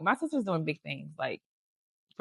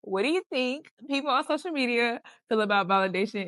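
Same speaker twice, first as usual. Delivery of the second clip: a lightly voiced mock of her sister, higher and louder; teasing imitation; 7 semitones higher, 6 dB louder than usual. Teasing her sister for answering her own question, playful mimicry. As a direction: parrot her question back with a grin. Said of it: "She said".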